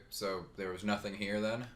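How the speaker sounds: normal talking voice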